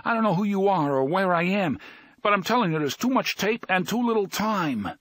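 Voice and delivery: high, strained, pleading voice; urgent high-pitched plea; desperate prisoner. A narrator's reading. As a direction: push it out like a man out of time. Eddie pleading under pressure.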